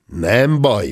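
ordinary delivery, nothing marked

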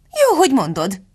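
high-pitched exclamation; calling out. A narrator's reading. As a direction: call across sharply to the cook. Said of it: "kiáltott a hercegnő a szakácsnénak".

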